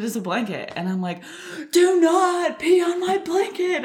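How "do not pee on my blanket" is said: high, slow mock-furious warning; retold anger. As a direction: imitate an outraged little kid. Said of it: "I'm getting so angry".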